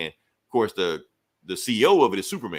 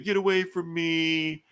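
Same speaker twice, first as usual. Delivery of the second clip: retold scream; 8 semitones higher, slower than usual. Silver Banshee pushing Superman away, high mock scream. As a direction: shriek it out high and drawn out.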